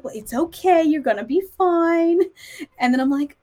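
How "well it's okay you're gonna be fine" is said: reassuring customs lady. Retold or imitated. Customs lady soothing a supposed child, babying her. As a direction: comfort her as if she were small. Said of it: "She's like".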